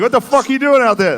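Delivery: high loud heckle; mock yell. Spectators yelling at a player who launched the ball, mock yell. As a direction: yell it from the bleachers. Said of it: "People would be like".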